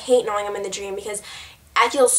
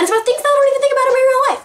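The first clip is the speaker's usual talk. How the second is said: rushed, high and louder gabble; too fast; 13 semitones higher, 5 dB louder, much faster than usual. Shown as a demonstration. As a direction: blurt the whole sentence at racing speed. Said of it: "Oh my gosh, that was so fast".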